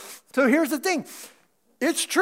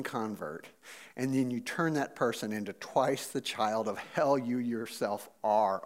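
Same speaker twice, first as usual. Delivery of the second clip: low, soft, grave reading of a rebuke; scripture reading; 9 semitones lower, 4 dB quieter than usual. Acted. Pastor reading Jesus rebuking the Pharisees, low and grave. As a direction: read the rebuke quietly and heavily.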